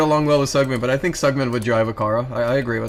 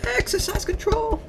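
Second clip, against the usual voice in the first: very high, quiet squeaky voice; odd voice; 5 dB quieter than usual. Acted. To chat, saying a line in a weird squeaky voice. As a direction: squeak it out like a cartoon. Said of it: "I don't know why I said it like that".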